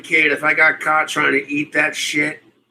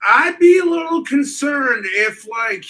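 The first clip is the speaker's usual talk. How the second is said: high, loud burst; a loud high yelp of concern; sudden shout; 9 semitones higher, 4 dB louder than usual. Real, not acted. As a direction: blurt it out loud. Sudden outburst on the topic.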